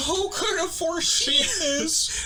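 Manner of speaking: high, drawn-out theatrical wail; mock dismay. Killer pretending to discover the body, hammed-up dismay. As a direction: imitate badly faked shock, slow and high-pitched.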